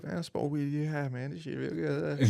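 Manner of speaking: high, fast laid-back stoner voice. Retold or imitated. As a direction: play a chill stoner bragging. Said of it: "it was like".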